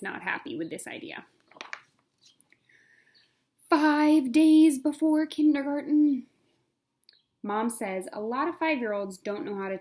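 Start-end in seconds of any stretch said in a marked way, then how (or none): 3.7-6.3 slow, high, loud chapter announcement; countdown heading. A narrator's reading. Reading a picture book to kids, announcing the day heading. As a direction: announce the day like a big title.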